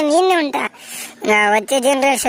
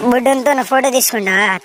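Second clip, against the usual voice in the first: ordinary delivery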